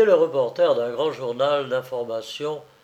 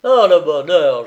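loud, delighted outburst; exclaiming; 3 semitones higher, 11 dB louder than usual. A narrator's reading. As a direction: cry out with sudden joy. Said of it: "il s'écria".

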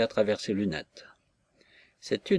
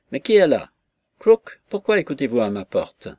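startled loud call; 3 semitones higher, 7 dB louder than usual. A narrator's reading. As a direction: call out at someone at the door. Old lady startled by eavesdropper.

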